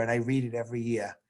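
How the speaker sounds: ordinary talk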